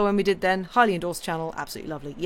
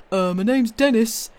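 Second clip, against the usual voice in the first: hammed-up silly voice quoting a chatter; mock chat voice; 4 semitones higher, 7 dB louder than usual. Streamer reading a chatter's joke, goofy put-on voice. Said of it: "Andy Pants".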